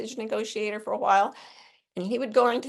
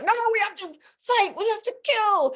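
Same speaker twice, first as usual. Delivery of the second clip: much higher mock angry shout; hostile mock shout; 14 semitones higher than usual. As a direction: play it as a frantic gunman. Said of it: "people would be like".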